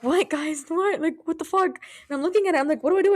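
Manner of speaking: high-pitched rising mock panic; panicked. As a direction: act out frantic questions to friends. Acts out panic over heart rate.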